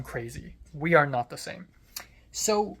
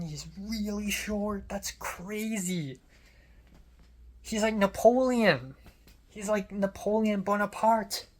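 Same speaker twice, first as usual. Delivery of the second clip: higher, drawn-out disbelief; amazed; 4 semitones higher, slower than usual. Real, not acted. Telling of seeing how short he is, amazed. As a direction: stretch each word out in amazement.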